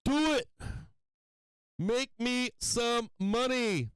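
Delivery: loud, high shouts urging the market on; shouting at the screen for a squeeze; yelling at the chart. Real, not acted. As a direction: cheer the trade up at full voice.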